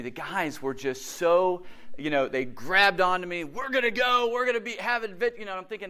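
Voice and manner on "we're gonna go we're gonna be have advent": higher-pitched mock excitement; eager rallying. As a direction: imitate pals rallying a new friend. Excited guys grabbing him.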